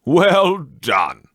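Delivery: put-on old master cheering the dancers, loud and hearty; Fezziwig cries out. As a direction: call it out to stop the dance. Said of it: "cried out".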